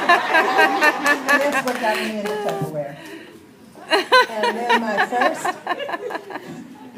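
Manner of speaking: loud laughter spilling into the words; laughing. Real, not acted. Telling the Tupperware crowd, laughing loudly. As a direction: laugh through the punchline.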